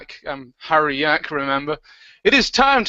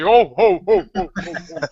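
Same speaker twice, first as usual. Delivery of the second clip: jolly ho ho ho. Acted. Santa laughs after blunder.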